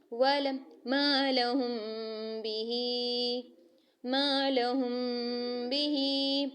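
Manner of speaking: slow chanted recitation with nasal ghunna; Quran recitation demo. Shown as a demonstration. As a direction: draw out मा लहुम बिही slowly, letting the meem hum through the nose. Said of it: "जैसे".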